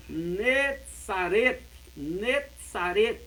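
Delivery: slow, careful "Netzaret" with ts and a final t; Hebrew pronunciation. Shown as a demonstration. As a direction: spell out "Netzaret" syllable by syllable. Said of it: "você não diz Nazaré".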